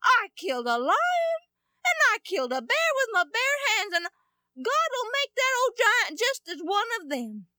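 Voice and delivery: put-on high, eager boyish insistence; high-pitched earnest young boy; determined young David. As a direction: prove to the king he can do it. David convinces King Saul.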